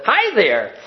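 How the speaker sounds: put-on high, gleeful baby greeting; squealing with glee. A narrator's reading. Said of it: "squealing with glee and said".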